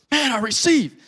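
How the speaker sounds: high-pitched imitation of an amazed man; freaking out. Retelling a guy's reaction to the testimony, freaking out. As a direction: gush it high, acting out his astonishment. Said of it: "freaking out".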